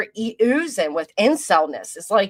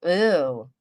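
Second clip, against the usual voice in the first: ordinary talk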